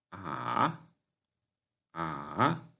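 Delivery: "a" dipping down, then rising; third tone. Shown as a demonstration. Teacher practising tones on syllable A, dipping "a". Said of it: "vamos a practicar los tonos".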